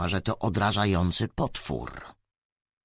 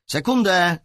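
loud, higher shout back; calling out; 8 semitones higher, 5 dB louder than usual. A narrator's reading. Boy in a game answers his sister, calling out. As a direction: call back over to your sister. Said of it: "zawołał do siostry".

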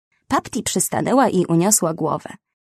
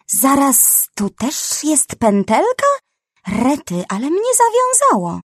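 higher, louder ranting snake; tied-up snake ranting about manners; angry snake; 6 semitones higher, 4 dB louder than usual. A narrator's reading. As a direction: splutter with rage, then fluster at the knot. Tangled snake rants.

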